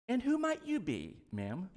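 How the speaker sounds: a hint of a bothered, surprised Boaz; startled Boaz. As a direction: play Boaz waking up uneasy and unsure. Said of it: "we don't know what his tone is".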